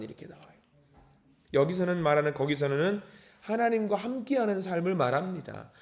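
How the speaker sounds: normal talking voice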